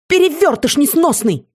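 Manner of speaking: high, heated name-calling; exasperated insult. A narrator's reading. Seer trading insults with shapeshifter, high exasperated cry. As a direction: hurl it out pitched well up.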